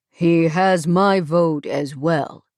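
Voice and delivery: lightly voiced gruff older man; gravelly older man. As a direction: speak with an imposing elder's weight. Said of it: "at the gravelly voice".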